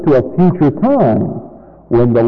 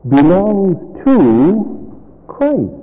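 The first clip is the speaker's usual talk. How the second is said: ordinary delivery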